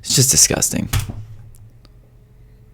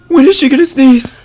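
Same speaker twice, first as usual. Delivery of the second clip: impatient shout; 12 dB louder than usual. Real, not acted. Impatient waiting for the sneeze.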